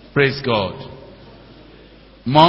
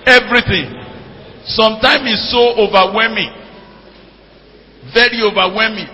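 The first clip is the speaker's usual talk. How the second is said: higher and louder, overwhelmed; excited preaching; 5 semitones higher, 5 dB louder than usual. Real, not acted. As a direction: burst out in delighted wonder. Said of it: "preaching excitedly".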